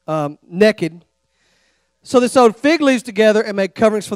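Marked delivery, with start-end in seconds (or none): 0.5-1.0 "naked" said as Texans say it, "nekkid"; Texas "naked". Shown as a demonstration. Preacher showing how Texans say "naked", with a drawl. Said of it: "so we say it like this".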